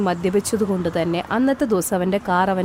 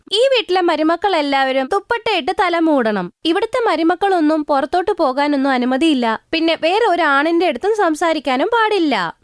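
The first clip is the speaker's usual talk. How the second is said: higher-pitched bossy mother-in-law voice; stern mother-in-law; 7 semitones higher than usual. Acted. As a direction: lay down the house rules like a strict mother-in-law. Mother-in-law lays down rules.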